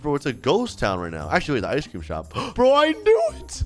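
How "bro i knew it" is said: gasp, then high-pitched exclamation; excited discovery. Real, not acted. Player spotting his next target, high and excited. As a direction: burst out high in triumph.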